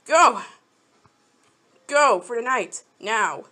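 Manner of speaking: sharp loud order to go; loud command. Real, not acted. Sending the pet away, loud sharp command. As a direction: shoo it off firmly, loud.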